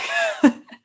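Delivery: a quick high burst of laughter. Real, not acted. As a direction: laugh at yourself for going off topic. Laughs at her own tangent.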